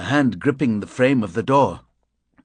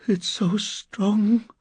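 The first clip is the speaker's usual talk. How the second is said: high, slow moan; drawn-out moan rather than speech; 10 semitones higher, much slower than usual. A narrator's reading. Miss Weimer curled on the floor, moaning. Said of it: "She was moaning, not speaking".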